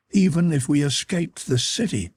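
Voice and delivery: lightly voiced sorrowful girl. A narrator's reading. Captive girl tells the man escape is hopeless, mildly sorrowful.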